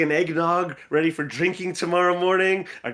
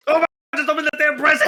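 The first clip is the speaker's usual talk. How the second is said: fast, loud, higher exasperated yell; impatient outburst; 8 semitones higher, 4 dB louder, much faster than usual. Acted. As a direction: snap at her to hurry. Impatient outburst at slow opening.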